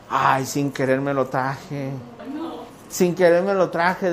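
mock innocent. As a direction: play the innocent who took it by accident. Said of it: "mi esposa me dice".

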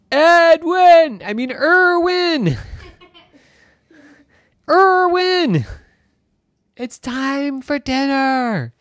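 high, loud call breaking into a laugh. Acted. Mom yelling for Irwin and laughing at her mistake. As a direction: call up the stairs, giggle at the name slip.

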